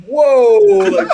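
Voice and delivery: high, loud surprised whoa; startled whoa. Retold or imitated. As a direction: recoil in shock. Reacting to Josh's outburst.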